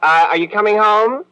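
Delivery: a cheating husband checking where his wife is; nervous fake cheer. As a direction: squeak out the question, covering panic.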